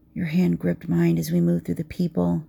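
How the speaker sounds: usual speaking voice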